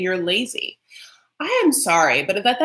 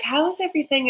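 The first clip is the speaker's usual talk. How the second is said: mock perky friend; 8 semitones higher, faster than usual. Acts out the friend's question.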